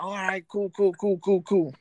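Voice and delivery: mock friends chiming along; hint of hyped friends; imitating friends. Friends agreeing eagerly.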